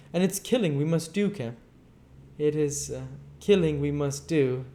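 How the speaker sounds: a feverish, scheming madman, loud and high; Invisible Man's scheming. Acted. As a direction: pitch it up and push it out like a man drunk on his plan. Griffin insists on killing.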